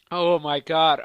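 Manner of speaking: ordinary talk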